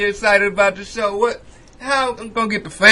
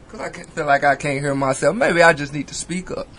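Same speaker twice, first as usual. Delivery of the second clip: ordinary delivery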